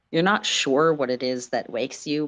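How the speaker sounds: plain reading voice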